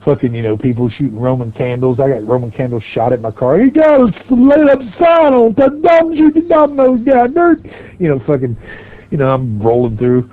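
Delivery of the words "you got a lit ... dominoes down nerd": high-pitched, drawn-out hollering voice; imitated high trailer-park yell; mock heckling yell. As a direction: holler it slowly like a far-off rowdy heckler. Imitates yelling from trailer park crowd.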